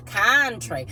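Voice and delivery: drawn-out country twang; country accent. Acted. Reader catches the accent she felt, country accent. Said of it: "I felt that country accent".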